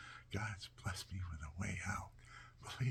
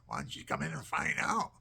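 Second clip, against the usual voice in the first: louder, higher comic comeback; mock indignant retort; 5 semitones higher, 7 dB louder than usual. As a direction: holler back at mother as the wronged baby. Baby's imagined retort to mother.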